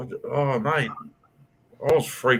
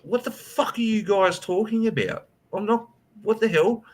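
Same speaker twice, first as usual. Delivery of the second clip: retold outrage; 8 semitones higher than usual. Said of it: "And I went".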